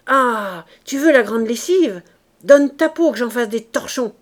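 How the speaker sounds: loud, hoarse fighting taunt; hoarse threat. A narrator's reading. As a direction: hurl the threat with a rough throat. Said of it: "une voix rauque". (menace criée d'une voix rauque)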